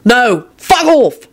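high, drawn-out shout; panicked, furious rebuff; angry refusal. Acted. William refuses to hand over wand.

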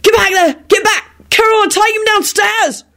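high, urgent shouted orders; panicked commands. Acted. Victor protecting the women from the wolf, urgent and high. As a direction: order them away in alarm.

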